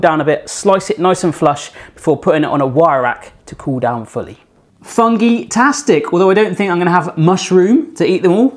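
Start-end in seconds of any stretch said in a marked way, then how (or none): 4.8-6.1 higher, drawn-out pun; cheesy pun. Real, not acted. Baker showing off the baked cakes with a pun, drawn out. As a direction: savour the pun slowly. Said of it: "Sorry about the bad puns".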